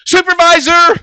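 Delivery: very high, very loud shriek of a word; mock yell. Clerk shrieking for her supervisor, very high and loud. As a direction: scream it across the office.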